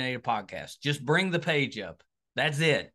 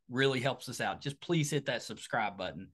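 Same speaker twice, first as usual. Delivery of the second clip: ordinary delivery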